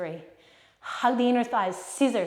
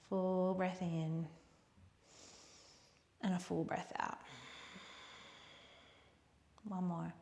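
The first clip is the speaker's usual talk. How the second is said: soft, hushed yoga closing voice; quiet, unhurried instruction with long pauses; guided relaxation; 3 semitones lower, 9 dB quieter than usual. Real, not acted. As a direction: guide them softly toward rest. Guides closing breaths and rest.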